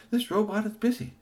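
high chirpy robot voice; busy robot. Acted. Robot repeats line.

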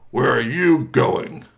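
slow, loud troll challenge; big troll. Acted. Big troll confronting Squeaky Toy, loud and slow. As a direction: boom it out slowly as a big troll.

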